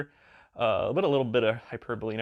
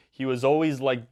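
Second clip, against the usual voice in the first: ordinary talk, nothing marked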